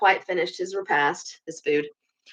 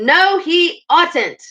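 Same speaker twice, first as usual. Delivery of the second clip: slow, high, loud interruption; shouted; 10 semitones higher, 5 dB louder, much slower than usual. A narrator's reading. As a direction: cut him off with a drawn-out shout. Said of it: "shouted the Mole with his mouth full".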